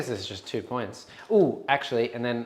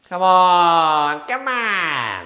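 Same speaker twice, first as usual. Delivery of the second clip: urging; 6 semitones higher, 9 dB louder, much slower than usual. Real, not acted. Urging dice roll.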